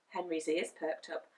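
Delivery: plain reading voice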